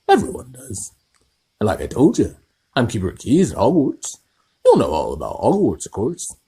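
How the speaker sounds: big hearty giant, loud and high; Hagrid. Acted. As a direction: boom it out like a friendly giant. Hagrid voiced in story.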